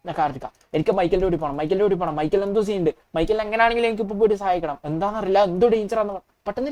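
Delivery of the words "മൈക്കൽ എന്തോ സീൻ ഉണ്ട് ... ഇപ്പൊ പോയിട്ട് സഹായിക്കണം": usual speaking voice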